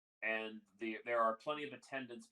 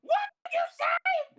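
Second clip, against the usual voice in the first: extremely high, loud screech; tiny squeaky voice; 15 dB louder than usual. Acted. Halfling yelling downstairs as guests go away, squeaky screech. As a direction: squeak it out at full volume. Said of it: "that tiny, squeaky voice".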